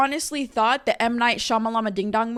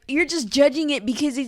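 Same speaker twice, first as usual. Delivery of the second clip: higher, whiny mimicry trailing into nonsense; mocking imagined critic; 5 semitones higher, 3 dB louder than usual. Imagined critic complaining about a favorite show, mocking. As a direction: mimic a nagging naysayer.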